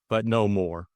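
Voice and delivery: plain reading voice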